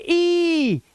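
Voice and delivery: "ई" high and drawn out; long vowel. Shown as a demonstration. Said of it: "long vowel sound निकालेंगे".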